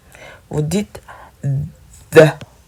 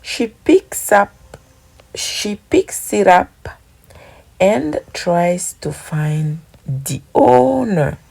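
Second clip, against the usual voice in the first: slow English reading; 3 semitones higher, slower than usual. Shown as a demonstration. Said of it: "reads the English example".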